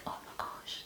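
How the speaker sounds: realizing in a hushed whisper. Retold or imitated. Cashier finally understanding toilet, whispered to herself. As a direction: breathe it out as a discovery. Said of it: "And I was like".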